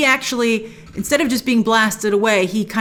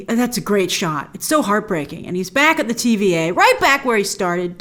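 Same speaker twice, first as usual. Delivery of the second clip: ordinary talk, nothing marked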